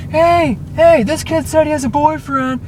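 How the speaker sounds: high, loud imitation of jeering kids; taunting call-out. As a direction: holler it to everyone around, mocking. Kids calling out to others.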